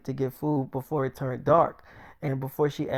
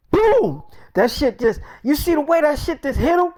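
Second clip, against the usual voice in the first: much higher, loud whooping excitement; thrilled yell; 12 semitones higher, 9 dB louder than usual. Retold or imitated. Friend cheering a zombie headshot, high loud yell. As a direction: cheer it like a knockout blow.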